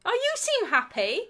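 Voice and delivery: pleased, friendly "Oh, you seem happy"; lilting, cheery "Oh, you seem happy"; cheerful. Shown as a demonstration. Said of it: "Or the other one is".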